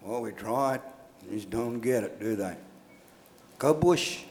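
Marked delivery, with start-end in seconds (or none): 0.0-2.6 lower, softer voice of giving up on them; mock resignation. Outsiders excusing their failed city schooling, mock resigned. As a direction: imitate officials shrugging it off, low and quiet. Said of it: "transplant them in a city ... and say".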